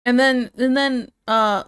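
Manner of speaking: usual speaking voice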